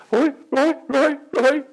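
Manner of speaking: high, loud mock calling of a name, laughing; wife calling out. Mocking his wife calling after him, high and laughing. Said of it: "My wife would go".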